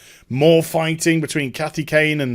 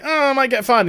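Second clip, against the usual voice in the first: high, fretful imitation; mock worried whine; 8 semitones higher than usual. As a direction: whine like a hapless employee. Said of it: "he's just like".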